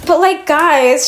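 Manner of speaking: ordinary talk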